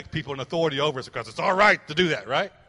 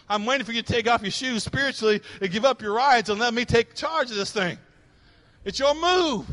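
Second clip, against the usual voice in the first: raised, emphatic divine challenge; preaching as God; 7 semitones higher, 6 dB louder than usual. Acted. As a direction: build to a high, commanding finish. Preacher voices God challenging the listener.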